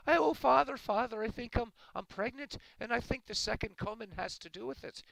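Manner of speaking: high, loud Irish woman wailing to her priest; hammed-up panicked confession in a brogue; distraught Irish woman. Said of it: "this Irish woman goes to confession".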